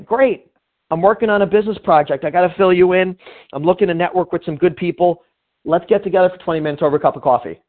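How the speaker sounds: keen networking invite over the phone; upbeat sales pitch. Shown as a demonstration. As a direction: rush through it with easy enthusiasm. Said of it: "call someone up and say".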